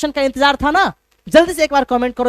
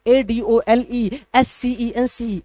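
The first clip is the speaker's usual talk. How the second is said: slow spelling out; much slower than usual. Shown as a demonstration. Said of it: "S के बाद सी लगाओ".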